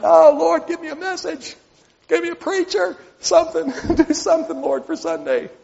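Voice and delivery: half-joking plea to God for Sunday; a hint of a desperate pastor praying; pleading prayer. Pastor voicing his own prayer.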